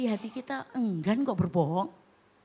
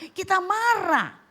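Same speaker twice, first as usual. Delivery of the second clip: emphatic preaching; 10 semitones higher, 7 dB louder, slower than usual. Real, not acted. Preaching at full force.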